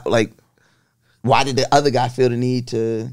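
ordinary delivery

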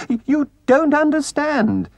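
very high, desperate little mole voice; high-pitched, distraught begging; anguished pleading. A narrator's reading. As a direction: plead frantically, stumbling over words. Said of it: "pleaded the poor mole in anguish".

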